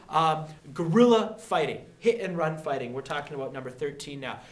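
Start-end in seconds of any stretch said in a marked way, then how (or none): none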